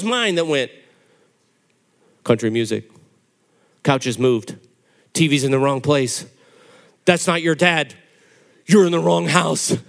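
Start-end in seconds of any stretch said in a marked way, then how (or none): 2.2-9.8 low, flat announcer reading an alert; news bulletin voice. Retold or imitated. Mind reading a news bulletin in a low announcer voice. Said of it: "all-point news bulletins".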